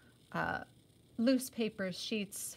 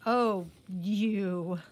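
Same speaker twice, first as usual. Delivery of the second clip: playful fake scolding, a touch louder; mock anger; 4 dB louder than usual. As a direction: pretend to be cross, fondly. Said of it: "pretending to be angry".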